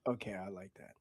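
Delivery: whispered "I like that"; hushed approval. Real, not acted. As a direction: whisper your approval under your breath. Reacts to the sexy line.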